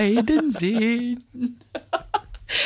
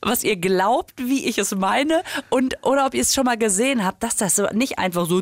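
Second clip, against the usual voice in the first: ordinary talk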